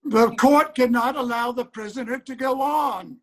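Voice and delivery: judge shouting down the prisoner; commanding bark from the bench; stern shout. Acted. Judge cuts off defendant's protest.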